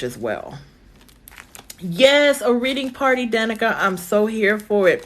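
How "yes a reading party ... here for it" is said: high, eager yes, easing down; excited agreement. Real, not acted. Reader welcoming Danica's reading party idea, excited. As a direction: jump on the idea with real enthusiasm.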